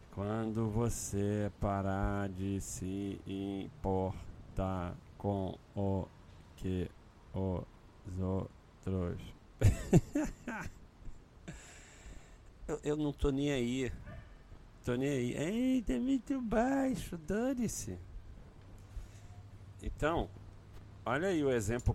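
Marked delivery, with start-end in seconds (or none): 0.1-9.2 very slow, drawn-out phrase; over enunciating. Real, not acted. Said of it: "eu vou falar bem devagar". 9.6-10.7 high laugh. Real, not acted. Laughs at own slowness. 15.4-18.0 high-pitched. Real, not acted. Mock reaction to complaint.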